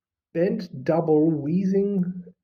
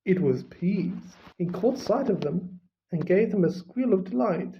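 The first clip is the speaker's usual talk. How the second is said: usual speaking voice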